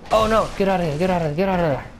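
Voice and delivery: high, panicked repeated urging; urgent alarm. Real, not acted. Escaping danger in game.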